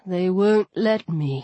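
lightly voiced low, resigned god; a hint of a grave male figure; solemn god. Voices Anubis in dialogue.

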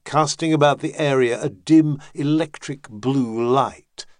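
ordinary delivery

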